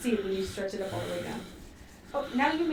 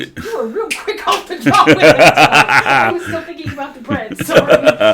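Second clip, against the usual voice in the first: laughing loudly through an apology; can barely get the words out for laughing; 16 dB louder than usual. Real, not acted.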